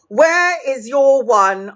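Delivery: retold slow, pointed question; exasperated demand. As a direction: spell it out slowly to a forgetful daughter. Confronting daughter borrowing her Vaseline.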